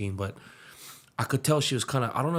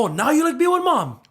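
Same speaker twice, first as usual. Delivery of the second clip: much higher, much louder mocking sneer; sarcastic jab; 11 dB louder than usual. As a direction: throw a cutting taunt at her. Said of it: "and I go".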